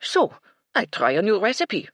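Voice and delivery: high, loud, breezy French chef; French accent shrug. A narrator's reading. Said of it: "with an expansive shrug".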